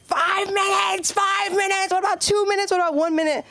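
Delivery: put-on high shrieking kids bargaining; protesting children yelling at teacher; screaming kids. Kids screaming about break.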